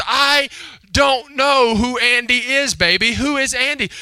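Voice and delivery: loud, slow, high-pitched denial; mock exasperated dad. Dad answers his insistent daughter, loudly exasperated. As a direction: imitate a father spelling it out forcefully.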